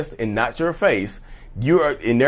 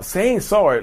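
ordinary delivery

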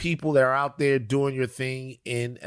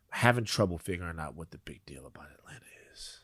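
whisper; 9 semitones lower, 9 dB quieter, faster than usual. Real, not acted. Confessing quietly about Atlanta.

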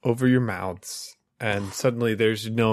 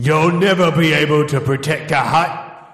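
louder, higher harsh father voice; stern disapproving father; 7 semitones higher, 4 dB louder than usual. Acted. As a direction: berate him like a cold father. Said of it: "Can you be my father who's telling me bad things?".

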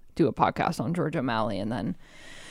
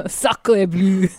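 hammy French exclamation; French accent; 4 dB louder than usual. Retold or imitated. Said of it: "your French listenership".

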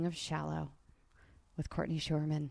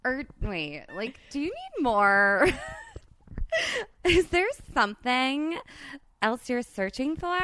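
lightly voiced Valley Girl, giggling between lines, pitched higher; 6 semitones higher than usual. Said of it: "Let's do it like Valley Girls".

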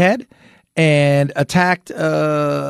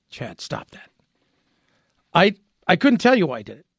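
normal talking voice

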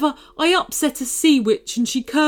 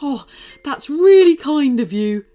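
higher, louder grinning creature; 6 semitones higher, 9 dB louder than usual. Acted. Shark thanking Liam, grinning. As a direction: say it through a big grin. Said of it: "grinned the shark".

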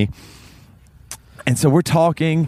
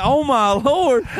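amazed exclamation; 10 semitones higher, much slower than usual. Retold or imitated. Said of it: "I went".

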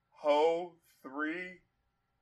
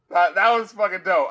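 laughing; 4 dB louder than usual. Real, not acted. Still laughing after shout.

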